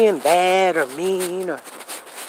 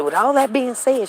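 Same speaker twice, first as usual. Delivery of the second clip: put-on girl's catchphrase; 6 semitones higher than usual. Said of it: "that one girl said it".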